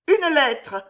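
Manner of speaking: sudden high cry; sharp surprised exclamation; exclaiming. A narrator's reading. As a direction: blurt it out, startled. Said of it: "s'écria Loïse".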